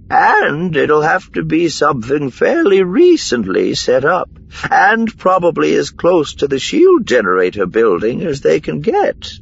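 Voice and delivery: high female character voice, eager; Miranda reasoning keenly. A narrator's reading. Miranda adds to Korn's deductions, high and keen. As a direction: reason it out, pitched well up.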